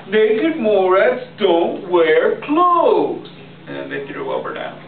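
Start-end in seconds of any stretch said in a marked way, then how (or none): 0.1-3.3 high, slow exasperation; exasperated. Acted. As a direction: spell it out slowly, annoyed. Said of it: "said the other naked Morats".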